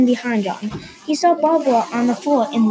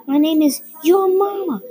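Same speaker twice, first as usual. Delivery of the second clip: high mock yell; narrated scream; 6 semitones higher, 4 dB louder than usual. A narrator's reading. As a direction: sass it out, pitched up. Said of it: "she screamed".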